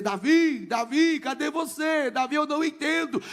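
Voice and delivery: imitated child calling out; retold desperate calling. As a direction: call out for your brother, half shouting. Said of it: "Ele saiu gritando".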